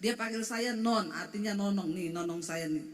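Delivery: ordinary talk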